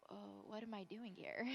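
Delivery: mock-helpless, under the breath; small muttered aside. Speaker wondering to herself why she came, small muttered aside. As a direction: say it softly as if to yourself. Retold nervous thought on stage.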